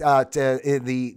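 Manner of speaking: ordinary delivery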